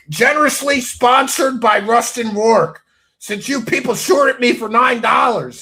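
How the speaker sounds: loud, high, hammed-up sponsor shout-out; mock grand announcement. Real, not acted. Loud jab at the chat.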